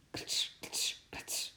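soft voiceless scrabbling sound effect; whispered scratching noises. A narrator's reading. Strange scratching from inside the moving van, softly whispered. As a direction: imitate faint claws scratching, whispered. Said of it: "klonken vreemde, krabbelende geluiden".

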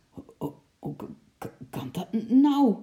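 starts breathless and whispered, stammering, then bursts out high; shy stutter. A narrator's reading. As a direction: stumble over every word in embarrassment. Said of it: "stotterde hij verlegen". (verlegen gestotterd)

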